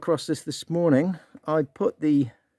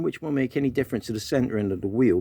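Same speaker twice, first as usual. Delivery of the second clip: ordinary talk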